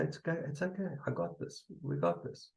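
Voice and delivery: put-on hushed, soft reassurance to a scared child; soft soothing voice. Shown as a demonstration. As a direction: comfort it gently, barely above a whisper on the last words. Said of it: "You say".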